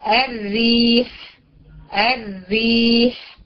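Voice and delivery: الريح very slow, thin double R; drawn-out الريح, R kept thin; light doubled ra. Shown as a demonstration. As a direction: stretch الريح with a light R and long i. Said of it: "تعال نجيب شدة وكسرة".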